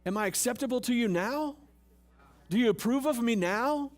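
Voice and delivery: a people-pleaser begging to be liked; needy mock plea. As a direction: beg for approval, mock-desperate. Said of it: "Stop trying to put on all this stuff and go".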